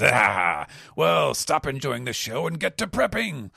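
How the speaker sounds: Blackbeard voice. Acted. Blackbeard orders Lafitte to stop watching, pirate command. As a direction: bark the order at Lafitte to get moving. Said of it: "Blackbeard says".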